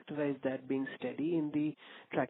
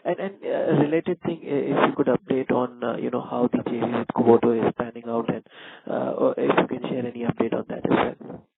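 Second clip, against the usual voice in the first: raised voice, asking a follow-up; speaking up; 8 dB louder than usual. Real, not acted. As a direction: keep it loud for the phone line. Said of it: "Can you please speak a bit louder".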